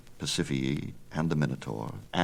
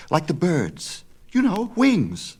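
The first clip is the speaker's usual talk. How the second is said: much higher, slow and excited; excited young Icarus; 13 semitones higher, 5 dB louder, slower than usual. Acted. Son urges his father, much higher. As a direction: thrill at the idea, high and slow.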